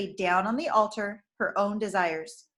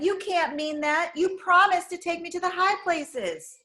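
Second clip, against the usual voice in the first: pleading cry; 8 semitones higher than usual. A narrator's reading. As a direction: beg him to take it back. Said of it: "she cried".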